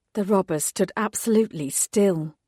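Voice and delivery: ordinary delivery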